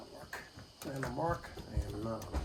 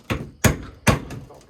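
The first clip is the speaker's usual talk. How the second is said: effort grunt; 14 dB louder than usual. Real, not acted. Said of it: "Sorry, I'm grunting".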